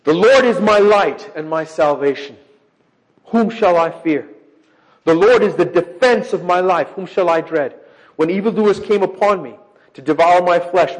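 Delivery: loud, declaiming scripture reading. Acted. Preacher reading the psalm aloud to his congregation, declaiming loudly. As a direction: proclaim the psalm to the room.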